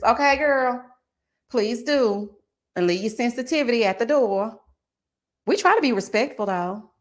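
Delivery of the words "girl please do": ordinary talk, nothing marked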